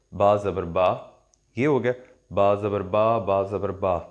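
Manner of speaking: 'با زبر با' with the final a held for two beats; two-beat long a. Shown as a demonstration. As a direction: stretch the a in 'با' to two counts. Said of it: "یہ دو دفعہ آپ نے open کیا".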